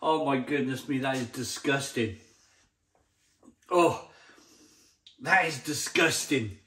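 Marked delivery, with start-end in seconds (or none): none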